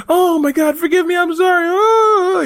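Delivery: very high, loud wailing plea; hammed-up crying. Fired actor begging the boss, hammed-up crying. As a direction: beg for forgiveness through mock sobs. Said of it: "He starts crying".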